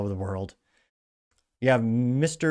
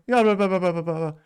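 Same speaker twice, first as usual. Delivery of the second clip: mocking blah blah; 8 semitones higher, 5 dB louder than usual. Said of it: "the girl that goes".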